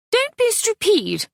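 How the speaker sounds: put-on high, sharp French-accented snap; sharp French scolding. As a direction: scold her like a haughty French mother. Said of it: "Mother said sharply".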